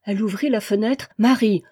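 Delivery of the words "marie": louder call through the window. Acted. Alix calls to Marie from the window, louder. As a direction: call down to the courtyard. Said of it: "appela-t-elle".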